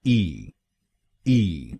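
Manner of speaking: ई as a light, short "ee"; just a quick ई, ee, dropping low; second syllable. Shown as a demonstration. Teacher modeling the second syllable: ई as a short ee. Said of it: "हम बोलेंगे".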